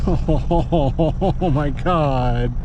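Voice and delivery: slow, melting laugh over a cute dog; laughing fondly. Real, not acted. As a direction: laugh through the words, charmed. Dog seen in the mirror.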